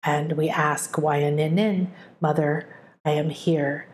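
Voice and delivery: prayer. Acted. Speaks a prayer aloud.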